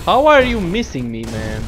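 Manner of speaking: high, loud exasperated outburst; loud frustrated. Real, not acted. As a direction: yell it in disbelief. Enemies keep missing him.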